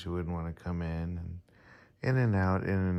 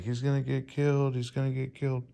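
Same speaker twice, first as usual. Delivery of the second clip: higher-pitched mock warning from worried viewers; worried commenters; 7 semitones higher, faster than usual. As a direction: imitate people fretting, pitched up. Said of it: "people are like".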